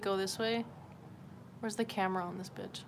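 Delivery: normal talking voice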